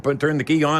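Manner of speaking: normal talking voice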